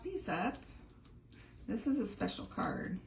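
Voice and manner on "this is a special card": usual speaking voice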